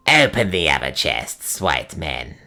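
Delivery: low, far-off croak of a hag; croaking old witch. A narrator's reading. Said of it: "croaked Gagul".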